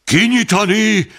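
a high shouted order from the crowd. A narrator's reading. The crowd yells at the president to open the sack, shouting. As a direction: bellow it open, a storm of voices. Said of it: "Hangorkán".

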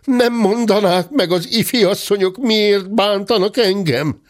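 high, alarmed polite question; scared gentleman's high-voiced plea; frightened pleading. A narrator's reading. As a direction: ask in a fright, pitched high. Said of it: "kiáltotta ijedezve a tekintetes úr".

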